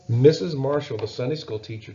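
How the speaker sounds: ordinary delivery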